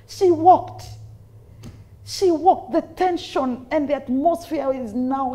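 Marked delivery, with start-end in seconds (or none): none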